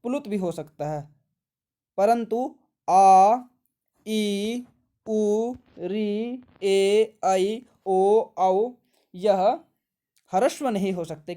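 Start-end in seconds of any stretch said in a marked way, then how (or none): 2.9-8.7 louder, slow, each vowel held out; drawn-out long vowels. Shown as a demonstration. Teacher listing long vowels for the class, held out loud.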